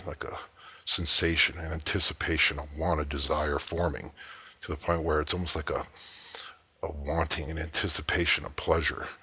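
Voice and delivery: hushed, measured patter with lingering pauses; seductive hypnotic suggestion. Acted. Man close in front of a woman, softly hypnotic. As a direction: softly draw out the suspense.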